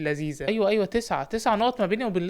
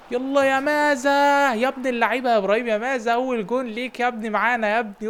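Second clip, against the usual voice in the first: goal celebration; 5 semitones higher, 3 dB louder than usual. Real, not acted. Coach celebrates new signing's first goal with a long cheer. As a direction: roar the name out long.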